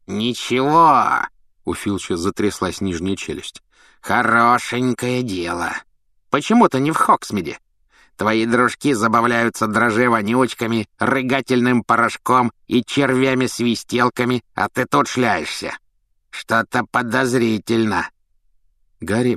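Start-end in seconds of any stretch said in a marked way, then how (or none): 0.1-1.3 slow, mocking sneer; scornful echo. A narrator's reading. Filch echoes scornfully. 4.0-18.1 grumpy caretaker. A narrator's reading. Filch voiced, grumpy.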